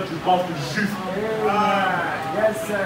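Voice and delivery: usual speaking voice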